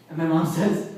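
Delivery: usual speaking voice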